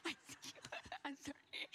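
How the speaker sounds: talking through laughter; real laughing. Real, not acted. Preacher amused by his own accented impression, laughing apology.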